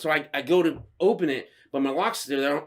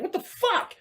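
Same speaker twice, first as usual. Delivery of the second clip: high-pitched, indignant disbelief; incredulous outburst. Retold or imitated. Driver reacting to a car swerving at him, incredulous. Retelling his startled road reaction.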